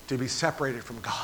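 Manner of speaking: ordinary talk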